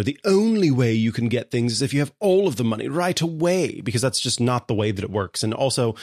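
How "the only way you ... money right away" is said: put-on snooty British accent, pitched a bit higher; mock a snooty Brit looking down on people. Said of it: "I went with the British accent there".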